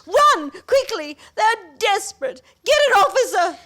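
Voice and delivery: high, loud urgent orders; urgent shout. Acted. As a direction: send him running for help. Urging someone to fetch police.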